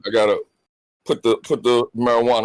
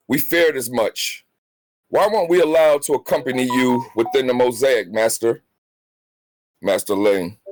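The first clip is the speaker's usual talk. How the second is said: dramatic comic narration, lightly voiced; storytelling voice. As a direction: read it like a fireside tale. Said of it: "my storytelling voice together for the people".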